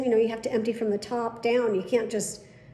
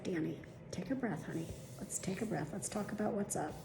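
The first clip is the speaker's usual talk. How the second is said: soft, hushed, calming the weeping patient; soothing doctor; 2 semitones lower, 14 dB quieter than usual. Retold or imitated. Doctor soothing his crying patient, soft and hushed. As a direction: gently talk her down, quietly.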